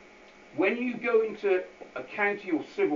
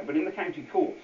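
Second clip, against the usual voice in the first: ordinary talk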